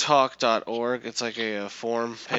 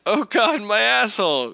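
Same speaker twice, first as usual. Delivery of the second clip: high, loud hammed-up wail; exaggerated yelp of mock agony; mock distress; 8 semitones higher, 6 dB louder than usual. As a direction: wail like a panicking trader. Mocking weak hands in distress.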